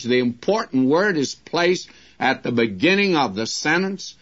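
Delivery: ordinary talk, nothing marked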